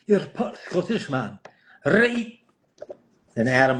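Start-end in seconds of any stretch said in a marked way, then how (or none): 0.1-2.4 playful Scottish burr, pitched up; Scottish accent. Acted. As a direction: slip back into a mock Scottish accent. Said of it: "Oh, Scottish".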